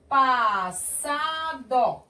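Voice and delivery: "passado" very slowly, syllable by syllable; som de S. Shown as a demonstration. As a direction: stretch "passado" as pa-ssa-do. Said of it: "Os dois S's". ("passado" bem silabado, com som de S)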